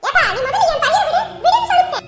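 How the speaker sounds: very loud, high shouting; both yelling over each other; hammed-up quarrel; shouting match. Mother and brother shouting match.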